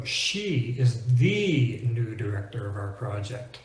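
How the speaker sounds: "the" stressed and said "thee"; emphatic "the", rhyming with "she", to single her out. Acted. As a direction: hit "the" as a long "thee". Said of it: "Sometimes we want to emphasize".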